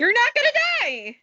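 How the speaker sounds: high and loud, laughing it off; loud exclaim. Real, not acted. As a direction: shout it over her. Friend reassures loudly.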